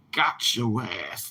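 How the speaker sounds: a slow low gloat at the removed cancer; low, slow mock taunt. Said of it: "Like that".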